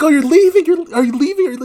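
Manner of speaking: high, loud alarmed pleading; mock panicked passengers. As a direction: imitate a passenger freaking out.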